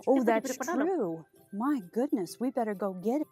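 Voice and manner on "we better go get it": ordinary delivery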